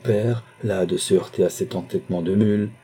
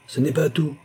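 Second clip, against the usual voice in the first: higher-pitched, agitated interjection; unable to contain himself; 7 semitones higher than usual. A narrator's reading. Numa turning to the father with more news, overwrought. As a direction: break in with more bad news. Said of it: "ayant le cœur trop gonflé pour pouvoir se contenir".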